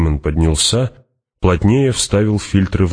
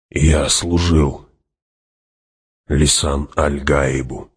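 slow, measured, pausing midway; solemn; slower than usual. Acted. Hawat tells Fremen whom he served, slow and solemn. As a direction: weigh each word of the statement. Hawat states his loyalty gravely.

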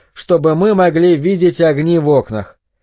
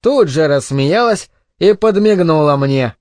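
usual speaking voice

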